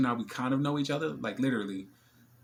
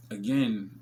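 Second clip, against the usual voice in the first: usual speaking voice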